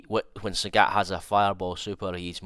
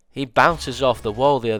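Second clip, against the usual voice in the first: excited; 4 semitones higher, 10 dB louder than usual. Real, not acted. Hyped by wall bounce.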